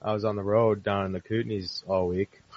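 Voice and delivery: distant, hollow phone line; echoing tunnel. Real, not acted. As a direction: sound like you're in a tunnel. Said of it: "you sound like you're in a tunnel".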